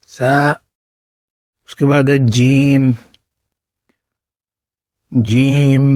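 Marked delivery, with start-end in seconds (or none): 5.1-5.8 جیم soft and gentle, a smooth j; soft Arabic jim. Shown as a demonstration. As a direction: say جیم gently, no hard edge. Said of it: "بالکل نرم طریقے سے".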